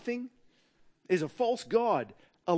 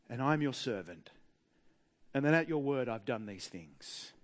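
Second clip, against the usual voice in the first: prayer; 4 semitones lower than usual. Acted. Voices Elijah's prayer.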